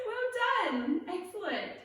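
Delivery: high-pitched cheer of approval; excited praise. Real, not acted. Leader praises players after their first try, excited and high. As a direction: praise them in a high, lifted voice.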